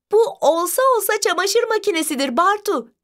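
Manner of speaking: high, delighted exclamation; joyful cry. A narrator's reading. Sister solves the riddle for her brother, joyful high cry. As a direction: cry out the answer with glee, pitched well up. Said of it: "diyerek sevinçle çığlık attı".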